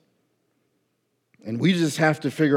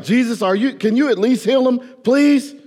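high, loud begging; urgent, rising plea; pleading prayer; 10 semitones higher, 5 dB louder than usual. Pretend. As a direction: plead with Jesus to heal them. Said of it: "and it's like".

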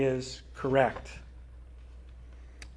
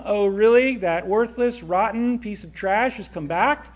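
loud, contemptuous sneer at the brother; scornful outburst; 6 semitones higher, 7 dB louder than usual. Acted. Older son scorning his brother's return, loud and contemptuous. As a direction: spit out the insult with disgust.

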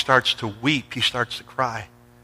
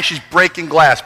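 ordinary delivery

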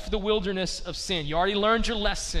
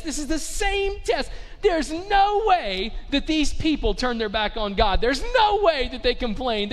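emphatic disbelief; 4 semitones higher than usual. Real, not acted. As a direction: hammer it home, astonished they could miss it. Preacher incredulous at Israel's complaining.

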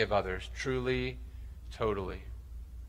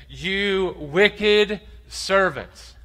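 very slow, loud, higher rebuke on "wicked"; stern condemnation; 7 semitones higher, 6 dB louder, much slower than usual. Acted. Master condemns the unforgiving servant, slow and stern. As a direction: draw it out like a king pronouncing judgment.

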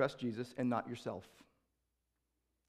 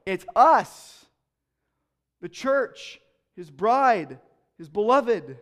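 loud, high preaching, stretching the words out; preaching at full force; 7 semitones higher, 11 dB louder than usual. Acted. Pastor to congregation, preaching at full force. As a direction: proclaim it to the whole room.

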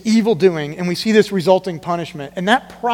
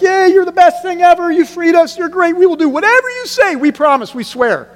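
high, loud, gushing praise; mock cheering crowd; 11 semitones higher, 8 dB louder than usual. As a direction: cheer like starstruck fans. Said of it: "They're like".

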